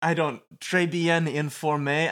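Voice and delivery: put-on high, loud hammy French accent; french chef. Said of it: "I don't know what that one".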